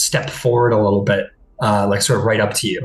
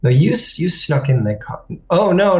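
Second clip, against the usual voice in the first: usual speaking voice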